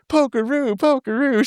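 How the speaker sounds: high, delighted repeat of the name; excited old lady. Retold or imitated. Older lady at the booth gushing over Pokeroo, high and excited. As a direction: gush the name like a fan. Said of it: "starts getting excited".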